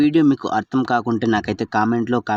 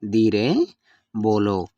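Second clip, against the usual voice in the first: ధీరే rising, pause, then బోలో falling; word by word; slower than usual. Shown as a demonstration. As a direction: split ధీరే from బోలో for learners. Teacher repeats phrase word by word. (పదం పదంగా విడదీసి)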